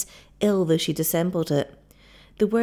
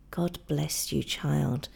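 soft, gentle blessing; tender; 3 semitones lower, 6 dB quieter, slower than usual. Acted. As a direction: bless her softly. Old man tender blessing.

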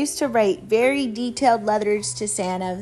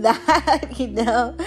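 usual speaking voice

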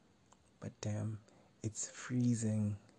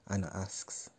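plain reading voice

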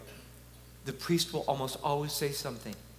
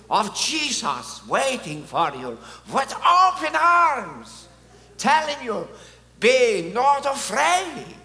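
put-on booming Polish accent, much higher, very slow and loud; John Paul II accent; 9 semitones higher, 9 dB louder, slower than usual. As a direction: proclaim it to the young in a grand Polish accent. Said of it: "how can I not use his accent?".

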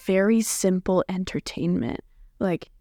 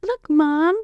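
high, gushing excited voice; excited kid voice; 9 semitones higher than usual. Retold or imitated. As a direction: gush about the cat like a kid. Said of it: "I'll be like".

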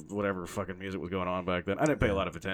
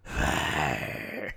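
low throat-straining growl; drawn-out gruff roar; Vince McMahon; 6 semitones lower, much slower than usual. Acted. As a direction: growl it out like a wrestling boss, sinking low. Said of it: "It hurts so much".

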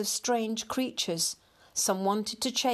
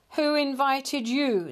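slow, louder, raised-pitch challenge; mild snarl; 6 semitones higher, 5 dB louder than usual. A narrator's reading. Tattered grey cat confronting a stranger, snarling. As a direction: challenge the intruder, drawn out and hostile. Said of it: "snarled a tattered grey cat".

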